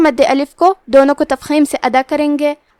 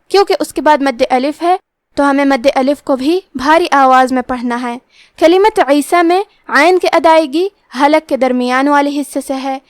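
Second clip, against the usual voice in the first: normal talking voice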